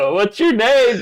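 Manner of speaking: very high put-on girl voice. Chatting up players to troll them, mock girl falsetto. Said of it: "put yourself a girl voice".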